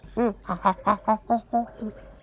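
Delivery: villain laughing at his own plight; evil cackle. Acted. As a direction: cackle like a mad scientist. Valdemar laughs at Sojo.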